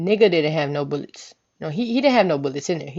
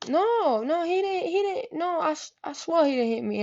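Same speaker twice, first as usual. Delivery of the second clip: high, soft, hurried protest; mock-anxious repeated denials; pleading denial; 12 semitones higher, 4 dB quieter than usual. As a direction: imitate a frightened girl covering for him. Girl's denial, acted.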